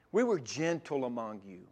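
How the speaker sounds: soft, low and tender; calm the voice down to show gentleness; gentle, calm. Shown as a demonstration. Preacher voicing Paul to his church, gentle and calm. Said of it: "help you feel the gentleness".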